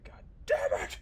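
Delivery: whispered mock anguish, hammed-up; fake rage. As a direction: ham up the anguish in a rasp. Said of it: "This is more appropriate".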